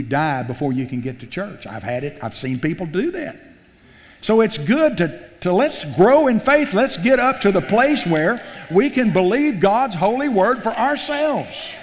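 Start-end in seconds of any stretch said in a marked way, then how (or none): 4.2-11.7 rising, loud preaching exhortation; preaching at full force. Real, not acted. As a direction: lift the congregation, full voice. Preacher builds to a climax.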